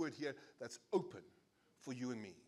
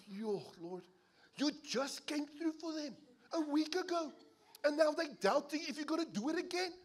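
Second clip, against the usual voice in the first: exasperated pastor; 12 semitones higher, 6 dB louder than usual. Retold or imitated. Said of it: "We're like".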